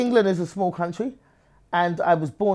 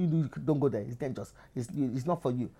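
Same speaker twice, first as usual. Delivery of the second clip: Nigerians cautioning; 3 semitones lower, 7 dB quieter than usual. Retold or imitated. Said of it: "Nigerians were telling me".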